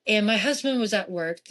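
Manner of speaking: ordinary talk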